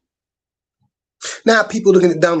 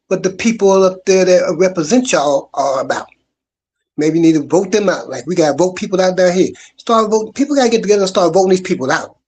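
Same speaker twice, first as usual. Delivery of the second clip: normal talking voice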